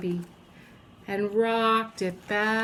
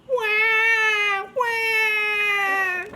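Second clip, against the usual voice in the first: put-on high wailing baby cry; baby crying wah; 11 semitones higher, 4 dB louder than usual. A narrator's reading. Baby cries at the teacher, wailing. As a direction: bawl like an upset baby. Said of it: "The baby looked at the teacher and said".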